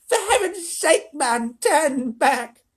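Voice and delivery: high, loud shriek; yelling grandma. A narrator's reading. Grandma Georgina yells at Wonka to reverse, high shriek. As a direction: bark it in panic. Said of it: "yelled Grandma Georgina".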